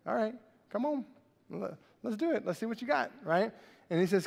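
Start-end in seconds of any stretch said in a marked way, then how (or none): 0.1-3.1 amused challenge. Retold or imitated. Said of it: "He's like".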